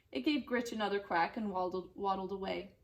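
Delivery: ordinary delivery, nothing marked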